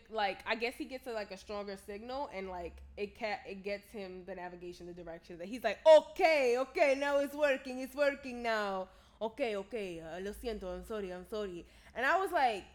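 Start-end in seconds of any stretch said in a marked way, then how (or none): 5.9-11.7 imitated Spanish-accented driver, loud at first then settling; mock Spanish-accented relief. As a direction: burst out in a Spanish accent, then trail into apology. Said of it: "This is all in a Spanish accent".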